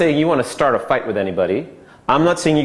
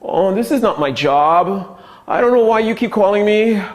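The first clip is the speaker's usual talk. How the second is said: overwhelmed excuse-maker, pitched up; whiny victim; 7 semitones higher than usual. Shown as a demonstration. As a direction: play the helpless victim begging off. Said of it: "The victim is the".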